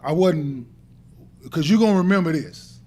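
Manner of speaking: ordinary talk, nothing marked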